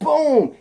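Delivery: high, loud punched-out "boom"; comic-book kick sound effect; impact boom. Acted. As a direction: explode on a single high "boom". Voicing a slam to ground.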